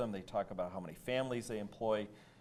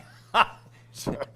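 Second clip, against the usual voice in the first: a loud, breathy real laugh; burst of laughter; 11 semitones higher, 13 dB louder than usual. Real, not acted. Host laughs at his cohost's quip, loud bursting laugh. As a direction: crack up at the joke.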